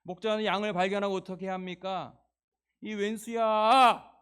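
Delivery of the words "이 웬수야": loud, high hammed-up scolding; mock scolding. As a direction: scold the sheep in mock anger. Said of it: "라고 성경에 나오지 않고".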